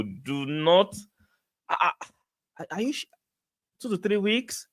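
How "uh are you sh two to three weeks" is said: high, soft, disbelieving question; can't believe the timeline; incredulous. Real, not acted. Streamer questioning a viewer's two to three weeks claim, soft disbelief.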